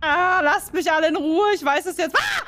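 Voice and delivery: loud, high, exasperated yelling; frustrated shout. Real, not acted. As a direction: yell it out, fed up. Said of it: "Ich bin sehr laut".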